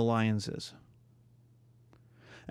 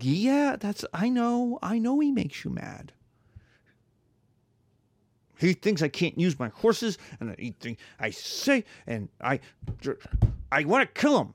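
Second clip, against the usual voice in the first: high whiny complaint; 6 semitones higher than usual. Acted. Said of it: "It's like".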